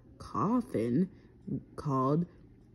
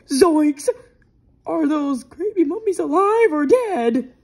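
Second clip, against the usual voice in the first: high, loud, panicky question; loud, high-pitched storybook fright; cartoon alarm; 10 semitones higher, 11 dB louder than usual. A narrator's reading. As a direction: cry out as if spooked by mummies. Shaggy's alarmed reaction in story.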